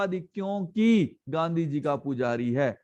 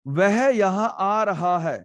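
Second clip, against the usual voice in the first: slow, louder, each word set apart; slow dictation read; 2 semitones higher, 4 dB louder, slower than usual. Shown as a demonstration. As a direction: dictate the example sentence slowly. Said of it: "देखो अपने मित्र से मिल सके".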